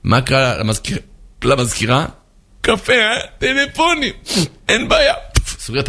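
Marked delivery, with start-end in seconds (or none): 2.6-5.1 high, wounded echo; acted high-pitched resentment; offended secretary. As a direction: repeat the insult back in a high, huffy voice. Insulted secretary echoes the insult.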